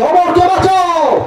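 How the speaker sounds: acted high-pitched shout; urgent cry. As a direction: cry out in alarm. Doctors running, calling for rescue.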